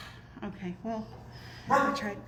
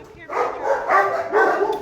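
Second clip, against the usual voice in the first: loud, slow, higher call; calling the dog; 6 semitones higher, 8 dB louder, much slower than usual. Real, not acted. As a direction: draw the dog's eyes up.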